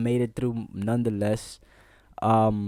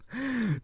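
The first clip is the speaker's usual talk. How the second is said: laugh; 14 semitones higher, 5 dB quieter than usual. Real, not acted. Laughs recalling the guys.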